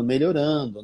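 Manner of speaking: ordinary talk, nothing marked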